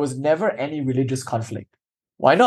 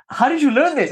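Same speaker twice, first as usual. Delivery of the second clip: much higher, amazed question; a scholar asks the maidservant, much higher and amazed; astonished scholar; 12 semitones higher than usual. Retold or imitated. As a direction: ask in disbelief, high.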